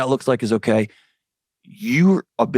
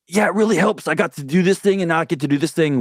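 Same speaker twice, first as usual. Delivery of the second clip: excuse-making addict; 6 semitones higher than usual. Retold or imitated. Voicing the addicted self's excuse.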